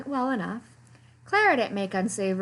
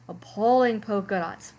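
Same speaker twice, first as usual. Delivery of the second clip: plain reading voice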